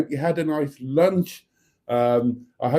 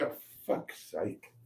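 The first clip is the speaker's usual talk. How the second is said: whispered curse; 4 semitones lower, 11 dB quieter, slower than usual. Real, not acted. Muttered curse under breath.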